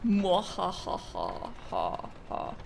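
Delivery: evil mwahahaha cackle; villain laugh, trailing off breathy; mad scientist. Acted. Gloating after taking souls.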